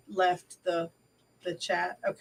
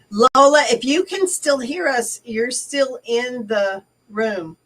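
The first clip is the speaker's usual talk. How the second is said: raised, higher-pitched call to someone; 5 semitones higher, 6 dB louder than usual. Real, not acted. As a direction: call out to a listener who may have dropped off. Calling to a dropped-out participant.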